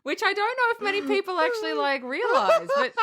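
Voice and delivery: high, giggly, hurried words; laughing while talking. Real, not acted. Host explaining the rude sash joke, laughing. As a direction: push the words out through laughter.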